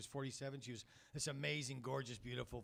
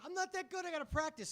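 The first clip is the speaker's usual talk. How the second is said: put-on much higher whiny child voice; little kid voice. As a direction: play the upset little boy, high. Crying kid voiced in story.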